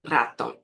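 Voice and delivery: Ratón with a trilled initial R; strong Spanish R. Shown as a demonstration. Teacher asking learners to repeat, trilled R. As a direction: roll the R of Ratón clearly.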